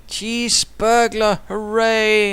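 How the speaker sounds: drawn-out, loud cheer for the cheese burglar; slow and high, a hammed-up hooray; mock cheer.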